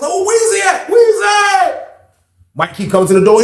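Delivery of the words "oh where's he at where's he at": inmates calling out looking for him. Retold or imitated. Inmates hunting for him, calling out high.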